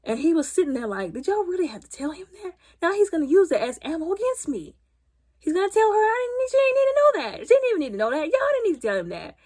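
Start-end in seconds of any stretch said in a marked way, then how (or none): 1.1-9.3 high, pleading mock whine; whiny high complaint. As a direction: whine it up high like a wounded groom. Groom complains about friends.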